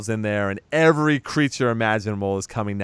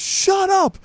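furious shout. Retold or imitated. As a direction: turn on him and scream it. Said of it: "Just turns on him and goes".

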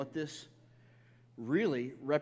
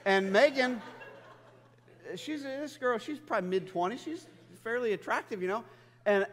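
ordinary talk

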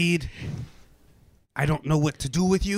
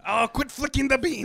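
high put-on voice; 7 semitones higher than usual. Scolding in put-on voice.

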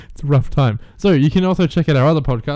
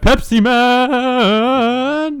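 Pepsi Man inflection; 9 semitones higher, 3 dB louder, much slower than usual. Shown as a demonstration. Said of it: "doing some kind of inflection".

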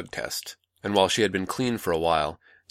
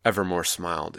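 plain reading voice

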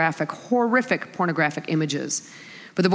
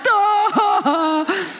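scared scream; 12 semitones higher, 4 dB louder than usual. Retold or imitated. Mom screaming on coaster.

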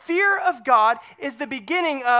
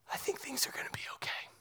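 breathless whisper, quick and hushed; hushed private hope; 4 semitones higher, 15 dB quieter, much faster than usual. Retold or imitated. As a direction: breathe it out as a secret relief. John's imagined inner thought.

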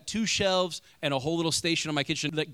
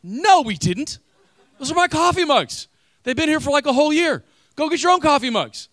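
loud, high, mock-outraged insistence; indignant protest; 12 semitones higher, 9 dB louder than usual. Pastor retelling the fight with his brother-in-law, mock outrage. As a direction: defend your mugs like a child. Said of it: "And I'm like".

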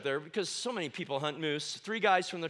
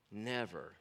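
soft, low, breathy never; a low, soft final word; hushed emphasis; 9 semitones lower, 10 dB quieter than usual. Real, not acted. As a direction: drop your voice to almost nothing.

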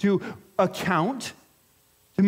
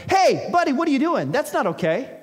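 higher, louder confrontation; confronting call; 6 semitones higher, 4 dB louder than usual. Retold or imitated. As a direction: call him out to his face. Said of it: "could have gone right up to him and gone".

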